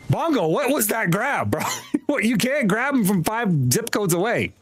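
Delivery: high-pitched incredulous complaint; mock outrage. Real, not acted. Gamer ribbing Bongo over a missed grab, high mock outrage.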